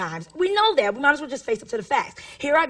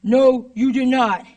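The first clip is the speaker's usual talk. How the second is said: slow, louder mock reprimand; principal on intercom; 5 dB louder, slower than usual. Principal disputes teacher's claim over intercom, slow and firm. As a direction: imitate a principal contradicting over the intercom, word by word. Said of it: "my principal yells out over the intercom".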